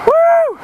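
piercing high woo; one long high whoop. Real, not acted. Vlogger by loud cars, high whoop.

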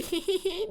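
high, quiet mock giggle; imitated giggle. Player imitating whoever was laughing here, mock giggle. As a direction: imitate an eerie little laugh, high and thin. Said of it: "Burada biri gülüyordu".

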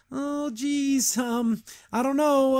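hedging like someone cornered into a bad idea; unsure hesitation. [name] hesitating at the plan.

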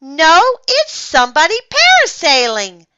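high, loud excited correction; shouting. A narrator's reading. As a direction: shout it over the others. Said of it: "I shouted".

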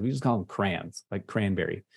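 "crayons" squeezed into one syllable, like "crans". Shown as a demonstration. Teaching remote class, Michigan one-syllable "crayons". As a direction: say "crayons" as a single clipped syllable, Michigan style. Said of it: "we don't have time for two syllables".

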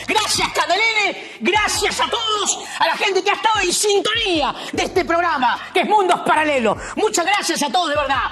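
high, shouty Argentine politician farewell; Milei voice. Acted. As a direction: bellow thanks like a fired-up Milei. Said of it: "no te puedes despedir sin hacer el grito libertario típico de Javier Milei".

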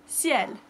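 ciel said syel, falling; French ciel. Shown as a demonstration. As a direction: model ciel in one syllable. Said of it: "So the word is ciel".

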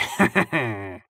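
gloating staccato chuckle; short run of cackling; clown's laugh. A narrator's reading. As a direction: laugh mockingly after the jibe. Clown laughs after his taunt.

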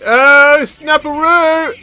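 high, stretched-out whoop; thrilled exclamation. Real, not acted. Player finding a gun, thrilled high exclamation. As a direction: draw it out high with glee.

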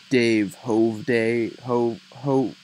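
usual speaking voice

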